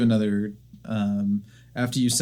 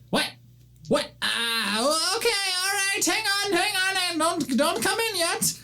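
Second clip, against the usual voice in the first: very high, hurried panicky voice; young gnome caught without pants, flustered. Acted. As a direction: scramble to stall the visitor.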